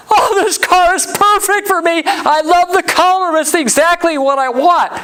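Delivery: imitating the wife swooning over the perfect car; gushing wife. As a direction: mimic her thrilled gushing at the car.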